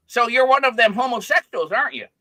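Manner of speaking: loud, high accusing punchline; Norm Macdonald. Acted. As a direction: pounce on it as a comic accusation. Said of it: "So Norm goes".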